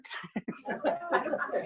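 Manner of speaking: high sheepish laugh at herself. Real, not acted. Admitting on air she forgot Arabic: high sheepish laugh. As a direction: laugh off forgetting the word.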